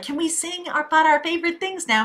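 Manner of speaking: put-on high, soft child's voice; little girl. Little girl asking while hiding in the abbey, high child voice. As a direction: ask like an eager little girl. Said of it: "the little girl's like".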